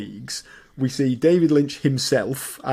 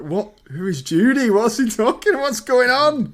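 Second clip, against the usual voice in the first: rising, high, incredulous questions; baffled; 10 semitones higher, 3 dB louder than usual. Retold or imitated. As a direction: sound totally lost and confused. Mock bafflement at the film.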